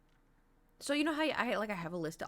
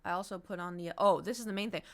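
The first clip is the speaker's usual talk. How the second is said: ordinary talk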